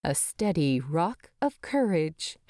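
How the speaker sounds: usual speaking voice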